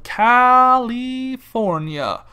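California stretched syllable by syllable; drawn-out showy naming. Acted. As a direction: draw out every vowel of California, loud in the middle. Showily naming his home state.

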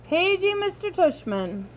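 a hint of high loudspeaker voice; mock paging voice. Augie echoing Dad's joke about the principal, mock paging voice. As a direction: lightly mimic the silly page call.

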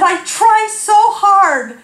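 high, slow, mock-pitiful plea; grumpy whine. Wife protesting to husband, grumpy whine. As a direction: drag it out in self-pity. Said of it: "Why have you been so grumpy".

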